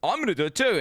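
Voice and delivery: high, loud imitation of careless players; mock gamer voice. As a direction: ham up a player gloating. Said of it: "everyone goes".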